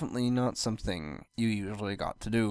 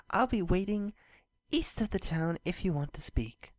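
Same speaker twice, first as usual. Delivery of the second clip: lightly voiced princess, a touch higher; Luna's letter voice; 7 semitones higher than usual. A narrator's reading. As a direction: read the letter as a gentle, hopeful princess. Reads Luna's letter aloud.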